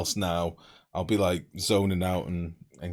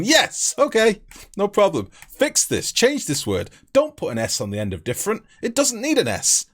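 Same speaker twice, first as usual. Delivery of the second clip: high, loud, emphatic correction; exasperated mock reply; 11 semitones higher, 6 dB louder than usual. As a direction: act out an eager, exasperated fix. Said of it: "I'd have gone".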